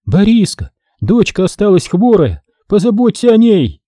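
high, pleading cry to her husband; begging him to care for their sick girl; desperate plea. A narrator's reading. Mother begs Boriska for their daughter, desperate cry.

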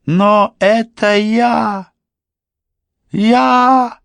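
высокий пронзительный крик; кричит, захлёбываясь чувствами; крик ребёнка. A narrator's reading. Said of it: "перешёл на крик". (высокий детский крик)

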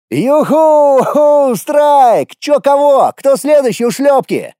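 high, loud gleeful yelling; triumphant whooping. Acted. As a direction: whoop like a kid scoring a strike. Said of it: "можно было так и не орать".

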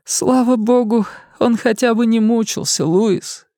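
high, weepy consoling voice; tearful consolation. Acted. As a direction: comfort him through tears. Missy consoling through tears.

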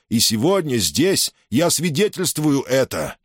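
high, ceremonial speech to soldiers; solemn public address. Acted. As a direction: proclaim the honour to the ranks. Governor honours Julius publicly.

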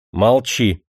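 sharp, imperious bark; commanding shout. Acted. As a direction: shut him up with authority. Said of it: "властно прикрикнул Демидов". (властный окрик)

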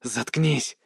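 choked cry through blood; through blood in throat. Acted. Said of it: "Слова вырвались через кровь в горле".

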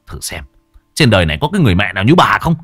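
angry son. Acted. Son rebukes his mother, rising loud and fast. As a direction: throw the blame back at her.

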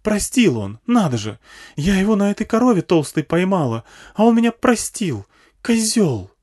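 high, loud, indignant rant; scornful, outraged woman; angry woman's yell. A narrator's reading. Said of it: "заорала зло тётка".

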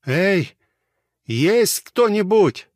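loud, high call into the dark; high drawn-out cry for help; yelling out. A narrator's reading. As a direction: shout for anyone to hear. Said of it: "заорал он".

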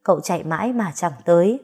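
ordinary delivery, nothing marked

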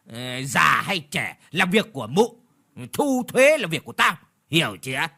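loud, high, bullying snarl; snarling landlord. Acted. Landlord bullies the old woman over tax, snarling. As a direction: bark the threat down at her. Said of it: "rồi gằn cái giọng".

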